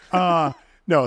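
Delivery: normal talking voice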